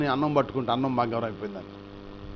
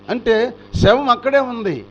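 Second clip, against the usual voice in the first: slow, higher and louder punch; preacher driving the point; emphatic point; 6 semitones higher, 6 dB louder, slower than usual. Real, not acted. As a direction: hammer home the body still lying there. Stressing David's devotion.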